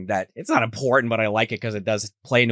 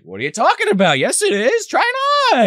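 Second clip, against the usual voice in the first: climbing high, coaxing put-on voice; imitating Peter; 5 dB louder than usual. Peter urging him to try the suit on, rising high. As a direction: push higher and higher as you insist.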